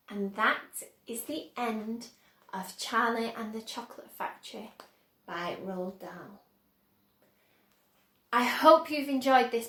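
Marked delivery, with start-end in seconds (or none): 0.1-6.4 story ending. A narrator's reading. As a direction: wind the book down gently. Closing the story reading.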